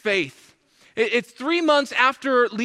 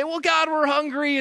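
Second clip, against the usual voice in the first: higher-pitched mild whine; mock crying out; 6 semitones higher than usual. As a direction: imitate a people complaining to God. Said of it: "they're crying out".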